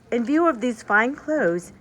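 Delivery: usual speaking voice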